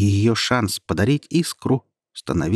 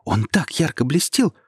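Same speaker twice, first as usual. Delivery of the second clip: excited; 7 semitones higher than usual. Acted. Boy excited about the ball.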